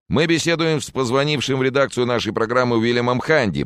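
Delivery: high-pitched on-air introduction; TV field reporter. Acted. Reporter presents a caller to viewers, high announcing voice.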